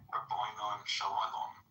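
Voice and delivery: slow imitation of an English accent. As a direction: draw it out in a put-on English accent. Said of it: "like the English accent way".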